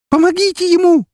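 пронзительный вопль, очень высоко и громко; кричи изо всех сил; крик. Acted. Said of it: "кричала Ксения". (Ксения кричит о помощи)

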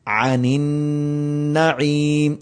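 slow chanted tilawat; melodic recitation. Acted. Teacher reciting the verse for his students.

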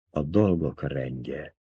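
murmured. Acted. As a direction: murmur it slowly under the breath.

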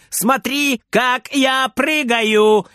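put-on high, drawn-out kid's shout; high slow showing-off call; shouting excitedly. A narrator's reading. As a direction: call for attention, stretching each word. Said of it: "кричит Фридер".

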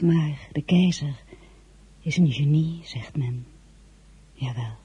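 calm reflective first-person narration; story narration. A narrator's reading. Narrator recalling her lonely days while the regiments march.

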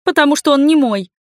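high, loud, cross reply; angry retort. A narrator's reading. Heroine snapping back at the vampire's taunt, angry retort. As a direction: fire it back with annoyance. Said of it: "ответила я зло".

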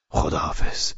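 low, hushed goodbye; subdued farewell. Acted. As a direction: say goodbye briefly under your voice. Low farewell, answered high. (خداحافظی آهسته و بم)

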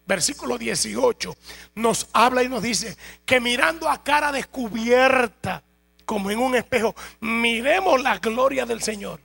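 loud, driving sermon delivery with slow emphatic stretches; fervent preaching. Acted. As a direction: preach it out full force to the congregation. Preaching at full force.

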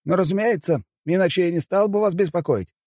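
high-pitched assistant voice; помощник председателя. A narrator's reading. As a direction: call the boss in a high voice. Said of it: "кивнул мужчина в строгом деловом костюме". (высокий голос помощника)

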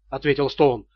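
usual speaking voice

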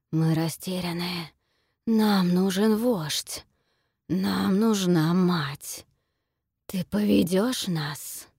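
slow, heavy creature voice; slow creature plea. Acted. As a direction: beg slowly like lost beasts. Creatures beg for a leader.

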